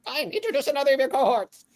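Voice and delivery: very high, fast, formal monster voice; a high, prim floating orb speaking; orb creature voice. Acted. GM as Gregory the orb, high and formal.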